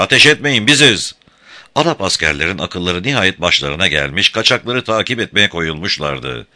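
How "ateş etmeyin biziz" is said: high, loud urgent plea; shout. A narrator's reading. Sarah shouting to Tom not to fire on them. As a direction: yell to stop the shooting. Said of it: "diye bağırdı Sarah".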